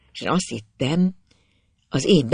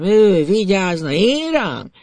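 slow, rising outraged disbelief; indignant anger; 4 semitones higher, 3 dB louder, slower than usual. A narrator's reading. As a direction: draw it out, higher, in offended fury. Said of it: "Iszonyú dühös lettem".

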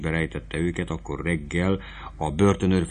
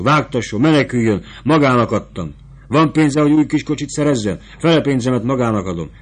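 loud, higher, hurried urging; urgent pleading; 5 semitones higher, 6 dB louder than usual. Acted. Fabrizio begs the sutler woman to flee, loud and fast. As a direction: rush the words, begging her to go.

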